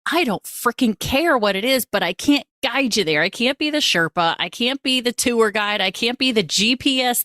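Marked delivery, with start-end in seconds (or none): none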